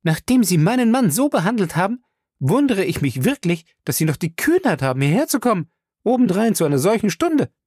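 high-pitched, angry reproach; cross and affronted, pitched high; indignant. A narrator's reading. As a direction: scold him indignantly. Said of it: "sagte sie böse".

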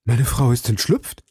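mild cry of surprise; a touch of startled disbelief; startled exclamation. A narrator's reading. Bonacieux learns of his wife's escape, startled. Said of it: "rief Bonacieux".

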